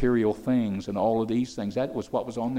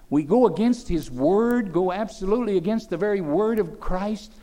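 high, rising preacher's appeal; preaching at full force; 7 semitones higher, 3 dB louder than usual. Acted. Preacher asking the church if they rebel against Christ, fervent. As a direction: build the rebuke toward the congregation.

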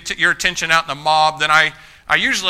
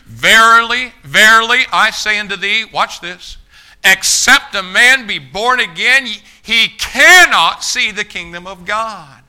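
solemn declaiming; 4 semitones higher, 7 dB louder, slower than usual. Retold or imitated. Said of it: "Jesus answered and said unto him".

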